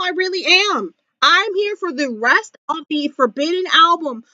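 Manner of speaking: ordinary talk, nothing marked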